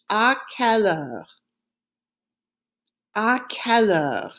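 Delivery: à quelle heure run together, ah-kel-uhr; French à quelle heure. Shown as a demonstration. Teacher models à quelle heure for students to repeat. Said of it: "Say it after me".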